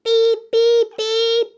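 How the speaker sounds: high, loud beep beep beep; urgent beeping. A narrator's reading. Stuck little truck calls everyone, loud high beeps. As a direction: beep for help as loud as you can. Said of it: "cried the little blue truck".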